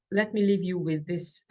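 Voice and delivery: ordinary talk, nothing marked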